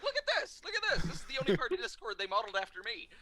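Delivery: hammed-up excited announcement, starting very high; mock excited showing off. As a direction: urge everyone to look, gleefully. Actor shows staff the picture.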